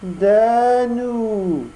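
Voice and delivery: high odd echoing cat voice. Acted. Cat echoes the fox in a high whine. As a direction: parrot the fox's last word. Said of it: "répéta le chat".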